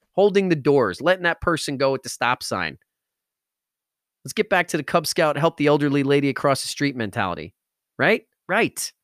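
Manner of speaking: normal talking voice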